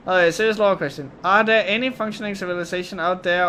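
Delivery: ordinary delivery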